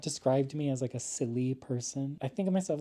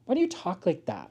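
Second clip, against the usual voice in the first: lightly voiced kid, higher; imitating a pupil asking, a bit higher; student asking her teacher, lightly voiced higher; 9 semitones higher than usual. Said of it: "some of the fucking kids would be like".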